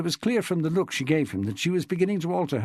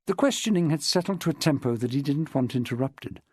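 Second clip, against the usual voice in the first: ordinary delivery, nothing marked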